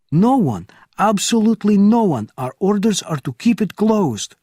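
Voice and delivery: high and loud; agitated insistence. Acted. Officer answering Bourne, high and loud. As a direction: insist it emphatically.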